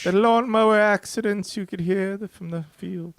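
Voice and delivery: put-on high, loud falsetto old lady. Co-host joins the old-lady bit, high and loud. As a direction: chime in as a gossiping old woman.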